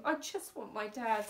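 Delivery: ordinary delivery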